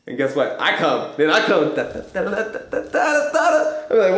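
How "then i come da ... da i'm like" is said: ordinary talk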